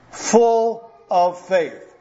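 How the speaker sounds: very slow, word by word; drawn-out emphasis. Shown as a demonstration. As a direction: stretch out each word to spell out the meaning. Spells out the contraction faithful.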